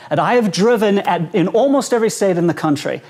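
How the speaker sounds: ordinary talk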